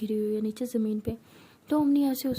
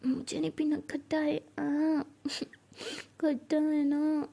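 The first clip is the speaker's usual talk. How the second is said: put-on childish whining; 4 semitones higher, slower than usual. Drunk Meera whines at lemonade.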